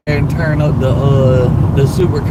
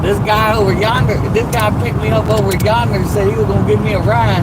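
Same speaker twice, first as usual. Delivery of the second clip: put-on country accent; 5 semitones higher than usual. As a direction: lay on a thick country accent. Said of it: "You said you're gonna turn your country accent on?".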